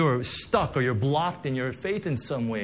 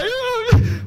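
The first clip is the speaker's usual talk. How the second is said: high, sobbing cry of oh; 8 dB louder than usual. Retold or imitated. Pastor reliving weeping before the Lord, high sobbing oh. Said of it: "I just cry".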